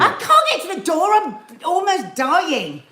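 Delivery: high, loud, laughing panic; breathless fright. Real, not acted. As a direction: gasp it out half laughing. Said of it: "I literally can't catch my breath".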